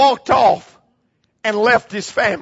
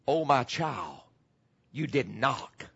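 much softer and lower, tender rebuke; gentle loving chide; 9 semitones lower, 10 dB quieter, slower than usual. Acted. God gently chides the child in the poem, soft and low. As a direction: hush it down like a loving father correcting a child. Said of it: "gently and lovingly chided".

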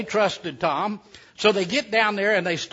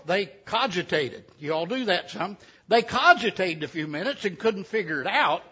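ordinary delivery

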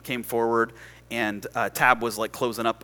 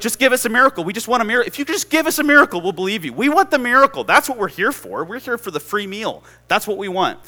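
high, loud, pushy clamour; insistent crowd demand; 10 semitones higher, 8 dB louder, faster than usual. Acted. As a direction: pester like an impatient, hungry crowd. Acted crowd demanding a miracle.

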